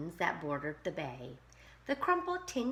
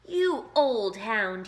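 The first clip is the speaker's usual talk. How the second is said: put-on slow, loud scolding boy; 3 semitones higher, 10 dB louder, slower than usual. A narrator's reading. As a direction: scold the dog firmly. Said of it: "he scolded".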